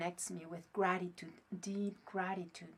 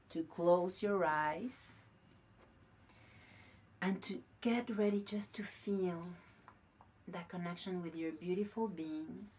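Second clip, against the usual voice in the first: guided meditation; 4 semitones lower, 6 dB quieter than usual. Acted. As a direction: lull them into calm, close and gentle. Leads a meditation.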